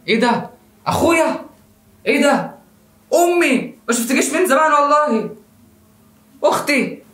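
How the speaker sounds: high-pitched astonished cries; amazed discovery. Acted. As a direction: act out stunned recognition, one relative after another. Acting amazed discovery of own family.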